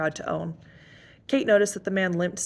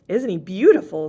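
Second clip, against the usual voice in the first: louder delighted squeal; 9 dB louder than usual. Acted. Emily delighting over the huge cat, squealing. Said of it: "squealed Emily".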